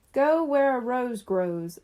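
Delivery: slow, careful reading of a clue; reading the note aloud. A narrator's reading. Lucy reads the clue from her paper, slowly. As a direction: sound out each word of the clue. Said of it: "reading from her paper".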